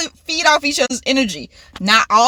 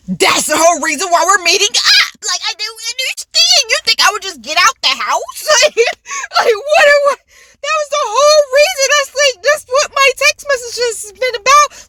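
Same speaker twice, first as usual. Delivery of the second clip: exasperated rant; 12 semitones higher, 4 dB louder than usual. Retold or imitated. Exasperated retold rant at friend.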